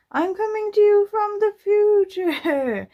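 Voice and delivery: high, loud, slow drawn-out call; voice from future. Pretend. Dropping a message in from the future, mock-grand calling. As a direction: call out grandly across time, stretching it.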